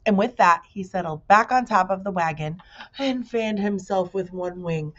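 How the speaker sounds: plain reading voice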